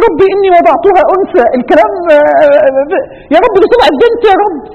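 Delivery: high, loud crying voice breaking; tearful cry. Retold or imitated. Preacher voicing the mother crying out to God. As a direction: let the voice break in tears. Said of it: "قالت".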